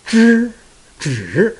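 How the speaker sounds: zhi dipped and rising; third tone. Shown as a demonstration. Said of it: "that's a third tone".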